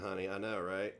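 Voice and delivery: ordinary talk, nothing marked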